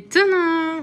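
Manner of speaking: high, loud sung-out fanfare; ta-da reveal. Real, not acted. Vlogger presenting the evening meal, loud triumphant ta-da. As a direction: sing it out like a grand unveiling.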